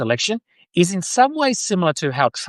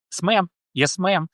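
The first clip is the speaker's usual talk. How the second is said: higher-pitched attempt at a Southern drawl; amateur Southern US "ma'am"; bad American accent; 4 semitones higher than usual. Shown as a demonstration. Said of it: "sound like I'm from the South".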